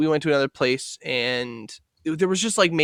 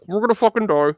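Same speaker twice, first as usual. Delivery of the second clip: underwater; 3 dB louder than usual. Shown as a demonstration. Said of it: "that was supposed to sound like I'm underwater".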